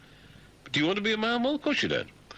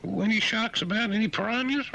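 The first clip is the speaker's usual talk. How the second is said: fretful swimmer. Pretend. The frightened swimmer asks about sharks, high squeal. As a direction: panic about sharks, pitched up.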